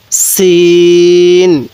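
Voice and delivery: সিন held very long, thin and firm s; thin hard sin. Shown as a demonstration. Teacher models Arabic sin, thin and hard, very slow. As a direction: draw out সিন with a thin sharp s. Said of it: "আওয়াজটা একটু চিকন করে শক্ত করে উচ্চারণ করব".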